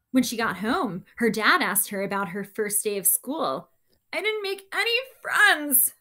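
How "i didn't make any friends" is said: high, loud crying complaint; cried out. Acted. Girl dinosaur wailing to her dad about school. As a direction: wail it like an upset little dinosaur. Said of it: "Penelope cried".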